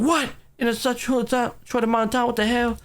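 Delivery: high-pitched imitation of astonished onlookers; mock surprise. Villagers reacting to a house built so fast, mock surprise. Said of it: "They're all just surprised".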